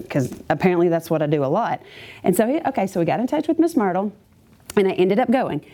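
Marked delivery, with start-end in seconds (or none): none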